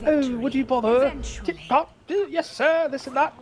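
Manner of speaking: very high-pitched caricature of British speech; hammed-up tip-top British toff accent; mock posh British. Mimicking Americans' idea of Britons, hammed-up posh British accent. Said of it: "American people think we all sound it".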